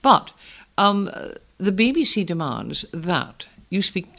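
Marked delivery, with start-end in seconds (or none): none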